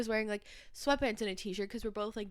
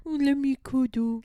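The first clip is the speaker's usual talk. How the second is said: mock cutesy; 3 semitones higher, 8 dB louder than usual. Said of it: "mocking the cuddles voice".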